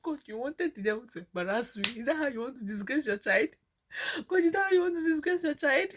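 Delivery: tearful complaint rising to a high cry; crying to God. Retold or imitated. She cries to God in the toilet, breaking into sobs. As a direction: sob out the accusation at God. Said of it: "I say".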